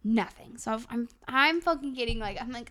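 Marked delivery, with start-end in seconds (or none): none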